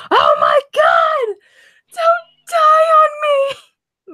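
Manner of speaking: high, slow, shrieking panic; panicked scream. A narrator's reading. Mo screaming at his injured best friend, panicked. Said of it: "Mo screamed this panic".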